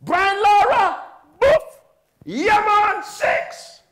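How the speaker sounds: very high, loud game-night holler; screaming over dominoes. Acted. Preacher imitating brothers at dominoes, screaming excitedly. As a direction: shout each move like a triumphant hit. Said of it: "You like to scream at Domino's".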